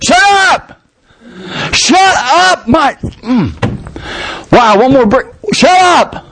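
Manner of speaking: high, irritated shouts of shut up; angry prisoners yelling. Acted. Prisoner yelling at Paul and Silas to shut up.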